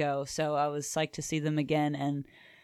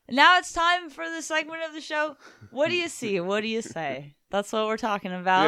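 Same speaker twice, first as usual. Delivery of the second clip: show announcer; 7 semitones higher than usual. Acted. Segment intro announcement.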